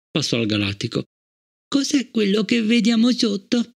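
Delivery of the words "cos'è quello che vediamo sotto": put-on high small child's voice, curious; a little girl asking, wide-eyed; child Fallon. A narrator's reading. Child Fallon asks Bliss about the sea below, high and curious.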